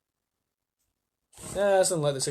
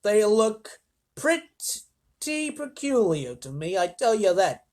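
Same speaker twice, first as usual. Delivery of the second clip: higher, very slow drawled bystander voice; man with binoculars; 6 semitones higher, 3 dB louder, much slower than usual. Acted. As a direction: squint and drawl it out. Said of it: "a man who had a pair of binoculars".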